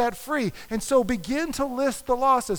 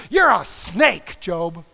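harsh accusation; 5 semitones lower, 5 dB louder, much slower than usual. Retold or imitated. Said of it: "forget nice".

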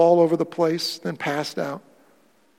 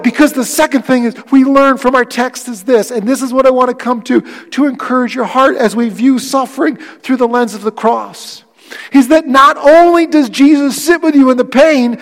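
louder and higher, slowing on the key lines; impassioned preaching; 7 semitones higher, 13 dB louder than usual. Real, not acted. Pastor assuring his grieving congregation they are not alone, fervent preaching. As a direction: pour comfort over the grieving, full voice.

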